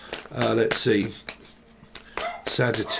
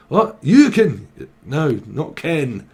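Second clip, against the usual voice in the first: ordinary talk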